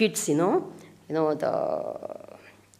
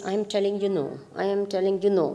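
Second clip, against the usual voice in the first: soft, lower inner voice; quiet warning; 4 semitones lower, 10 dB quieter, faster than usual. Acted. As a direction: warn softly, as an inner voice. Voicing the intellect's quiet warning.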